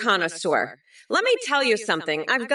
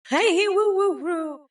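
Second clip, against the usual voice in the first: playful whoop; 10 semitones higher than usual. Pretend. Candidate punctuating a silly ten-step plan, high whoop. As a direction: whoop it out high between points.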